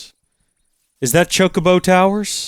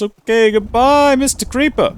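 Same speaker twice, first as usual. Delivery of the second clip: mock goodbye; 8 semitones higher than usual. Taunting a creeper goodbye.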